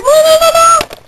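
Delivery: high, excited string of no's; giddy squeal. Real, not acted. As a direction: squeal over Edward. Playful squeal at Edward.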